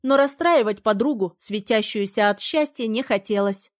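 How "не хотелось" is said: usual speaking voice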